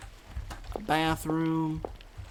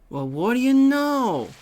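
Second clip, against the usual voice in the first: slow, loud, higher drawn-out taunt; menacing stranger; 9 semitones higher, 7 dB louder, slower than usual. Acted. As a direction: stretch each word like a sinister showman. Same figure again.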